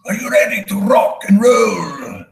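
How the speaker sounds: showman's high, ringing rock-frontman announcement; high-pitched, theatrical concert intro. Acted. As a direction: introduce the band to a stadium, high and grand. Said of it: "[name] knew how to introduce a show".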